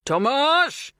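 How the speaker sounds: high, carrying shout of a name; booming call. A narrator's reading. Bernard calling through a shut door, booming. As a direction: boom it out to someone indoors. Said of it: "he called in a booming baritone".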